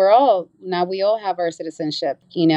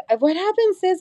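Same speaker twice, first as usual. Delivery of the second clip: concerned sister; 12 semitones higher than usual. Retold or imitated. Sister worried on the phone.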